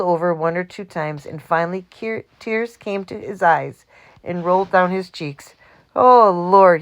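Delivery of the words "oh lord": louder burst of "Oh, Lord" through laughter; a man recovering from a fit of laughter; laughing cry. A narrator's reading. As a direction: cry out, still shaking with laughter. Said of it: "he cried when he could finally speak".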